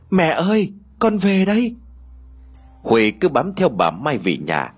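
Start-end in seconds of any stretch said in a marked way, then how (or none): 0.1-1.8 high call across the house; calling out loudly. A narrator's reading. Huê calling to her mother, loud high call. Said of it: "lớn tiếng gọi".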